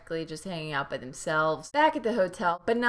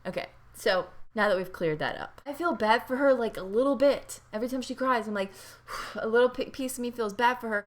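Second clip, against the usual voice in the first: normal talking voice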